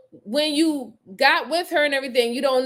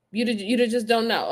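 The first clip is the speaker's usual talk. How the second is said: normal talking voice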